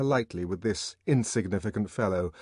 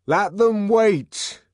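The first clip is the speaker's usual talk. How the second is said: loud, slow refusal shouted back; curt shout; 13 semitones higher, 11 dB louder, much slower than usual. A narrator's reading. The savage refusing Bernard through the door, loud and slow. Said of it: "came back the muffled voice through the door".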